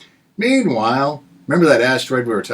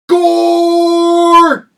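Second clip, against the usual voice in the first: yell; 6 dB louder than usual. Acted. Yelled pun on gored.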